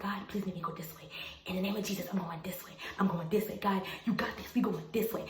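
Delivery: softly whispered pleading prayer. Retold or imitated. Teen girl whispering a prayer to God while waiting, whispered. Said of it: "I remember I was praying to God".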